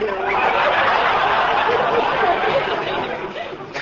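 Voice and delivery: high breathy cackle. Acted. Maid cackling over her husband story. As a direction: laugh at the mop joke.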